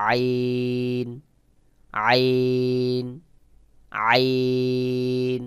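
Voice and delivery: আইন squeezed from the throat, very drawn out; Arabic আইন, slow and held on a level note; throat-pressed আইন. Shown as a demonstration. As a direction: chant a long আইন with slight throat pressure. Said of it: "গলা একটু চাপ দিয়ে উচ্চারণ".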